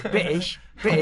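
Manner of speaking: BRITISH with the T dropped, imitating Americans; mock American-does-British. Briton mocking Americans' fake accent, dropped T. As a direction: say British as Bri'ish, swallowing the T. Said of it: "you just don't pronounce the T".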